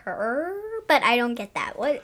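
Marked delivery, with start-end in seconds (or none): 0.0-0.5 soft whispered purr; a breathy whispered purr; cat purr. Retold or imitated. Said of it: "the cat says".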